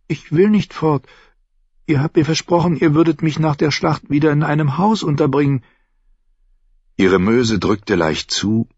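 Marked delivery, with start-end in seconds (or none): 0.1-5.6 junge Frau, höher gesprochen, neugierig; Shay. Acted. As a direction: fragt ihn leise drängend aus. Shay widerspricht. (Shay, junge Frau, höhere Stimme)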